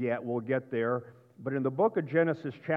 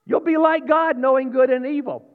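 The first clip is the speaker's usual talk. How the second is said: high, shouted promise; ringing, over-the-top temptation; loud tempter's promise; 10 semitones higher, 10 dB louder than usual. Acted. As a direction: proclaim the lie at full voice, pitched way up. Repeats the tempter's lie loudly.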